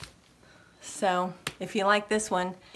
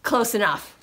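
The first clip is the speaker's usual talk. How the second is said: ordinary delivery, nothing marked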